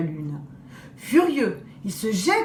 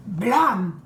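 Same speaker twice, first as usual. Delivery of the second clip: crash sound. Acted.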